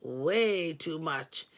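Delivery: slow, low brush-off; slow dismissal. A narrator's reading. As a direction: drag it out, unimpressed. Caller dismisses price.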